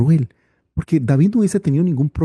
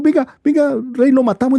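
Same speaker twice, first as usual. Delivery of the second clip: eager urging; 11 semitones higher, 3 dB louder than usual. Acted. Officers urging the king.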